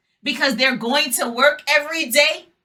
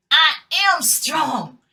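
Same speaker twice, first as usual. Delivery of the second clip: slow affirmation; 2 semitones higher, slower than usual. Shown as a demonstration. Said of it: "Everybody say it with me".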